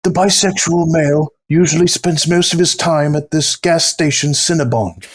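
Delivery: lifted, hushed wildlife narrator; nature documentary narrator. Retold or imitated. Continuing the nature documentary bit.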